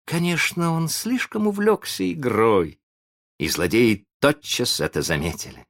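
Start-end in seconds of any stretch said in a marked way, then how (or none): none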